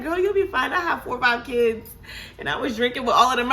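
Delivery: high-pitched, carefree reassurance, fast at the end; high breezy nurse. Retold or imitated. Nurse shrugging off a nervous patient, high and carefree. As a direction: toss it off like a seasoned mom of five. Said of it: "She's like".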